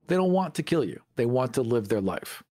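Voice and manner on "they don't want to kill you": ordinary talk, nothing marked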